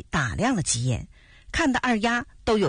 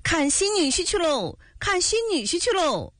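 put-on children calling out gleefully; 10 semitones higher than usual. A narrator's reading. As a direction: call it out like excited village kids. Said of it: "边跑还边喊着". (孩子们欢快地高声喊)

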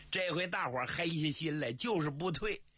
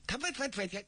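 high, stammering panicked denial; flustered stammer; 12 semitones higher, faster than usual. Acted. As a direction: stumble over the no's, caught out. Zhao Bi caught, flustered denial. (慌张结巴地否认)

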